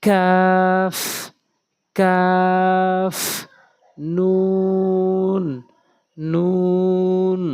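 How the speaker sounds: each Arabic letter held long; letters chanted slowly and clearly; drawn-out letter names. Shown as a demonstration. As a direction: recite each letter slowly, syllables stretched.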